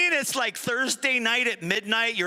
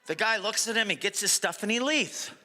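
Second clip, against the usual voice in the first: ordinary delivery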